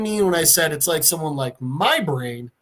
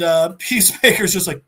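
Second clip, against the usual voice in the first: plain reading voice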